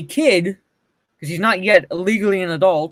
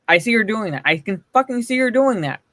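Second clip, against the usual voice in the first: usual speaking voice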